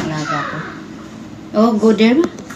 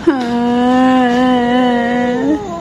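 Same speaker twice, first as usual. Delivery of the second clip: mock crying; 5 semitones higher, 4 dB louder, slower than usual. Nanny fake-crying to the child for the donut. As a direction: pretend sobbing with a laugh in it. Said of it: "I'm crying".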